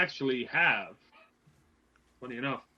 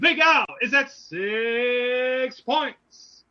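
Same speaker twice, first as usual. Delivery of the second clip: auctioneer voice; 8 semitones higher, 6 dB louder, much slower than usual. Acted. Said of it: "I had to get my auctioneer voice ready".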